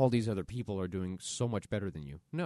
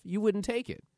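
normal talking voice

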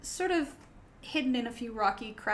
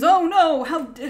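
loud, high-pitched "oh no"; fake dismay; 5 semitones higher, 15 dB louder than usual. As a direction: cry out in put-on alarm. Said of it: "faking that a wheel has had a problem".

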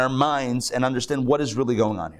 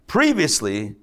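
TV recap announcer; 6 semitones lower than usual. Pretend. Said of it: "on Murder, She Wrote".

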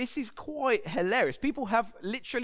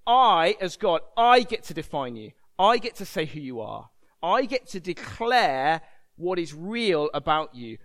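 loud, emphatic 'I' statements; forceful divine declaration; 6 dB louder than usual. Acted. God telling Gideon who gets to define him, forceful. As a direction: declare your authority, punching the first word. Preacher speaks as God overruling labels.

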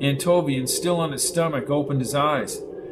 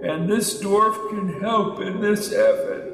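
ancient elder; 6 semitones higher, slower than usual. A narrator's reading. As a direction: intone like an ancient sage. Elder speaks.

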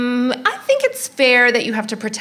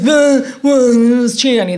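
louder, mock bumbling excuse; mocking the boyfriend; 3 semitones higher, 6 dB louder than usual. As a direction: blurt it out like a hopeless liar, mocking him.

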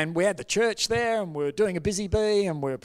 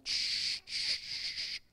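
soft, unvoiced shushes; repeated hushing; 10 dB quieter, much slower than usual. Holy Spirit keeping the pastor silent, soft repeated shushing. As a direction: hush gently, three drawn-out times. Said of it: "The Holy Spirit's going".

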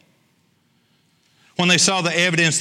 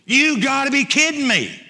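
pitched up high in disbelief; incredulous; 7 semitones higher than usual. Acted. Preacher voicing the stunned disciples, incredulous. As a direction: say it like you can't believe the order.

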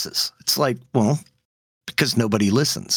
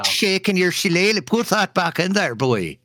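high, mock Irish lilt dropping at the end; playful, badly faked Irish accent; hammed-up Irish accent; 7 semitones higher than usual. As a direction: ham it up as a stage Irishman. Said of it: "I can't do an Irish accent".

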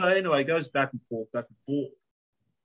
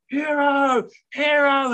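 high-pitched rallying shout; retold cheer, stretched out; yelling; 11 semitones higher, 4 dB louder, slower than usual. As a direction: imitate an old man hailing a hero. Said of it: "this old guy's yelling".

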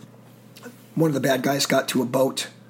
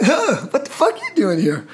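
higher, laughing, imitating his friend's surprise; a startled laugh at an old buddy turning up; imitated disbelief, high with a laugh; surprised laughing greeting; 10 semitones higher, 4 dB louder than usual. Friend surprised to see him.

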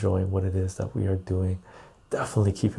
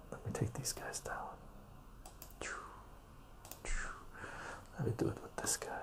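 whispering; 9 semitones lower, 23 dB quieter, faster than usual. Real, not acted. Muttering to himself off mic.

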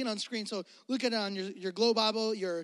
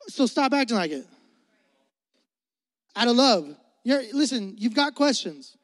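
full-force preaching; 7 dB louder than usual. Real, not acted. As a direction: drive it home to the congregation. Preaches over congregation.

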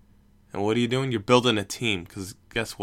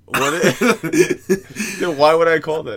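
louder, higher, through laughter; laughing incredulously; 7 semitones higher, 8 dB louder, slower than usual. Real, not acted. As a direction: ask it while cracking up. Cracking up at brother's mistake.

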